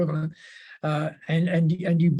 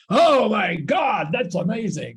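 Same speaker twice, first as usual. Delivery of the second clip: hammed-up shout of an excited Aussie bloke; 6 semitones higher, 5 dB louder than usual. Aussie bloke reacting to great news, hugely excited. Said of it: "an Aussie bloke would get very excited by that news".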